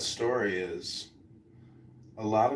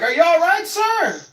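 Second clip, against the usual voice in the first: high polite voice; 11 dB louder than usual. Retold or imitated. Himself, politely calling after the man.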